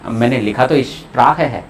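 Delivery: usual speaking voice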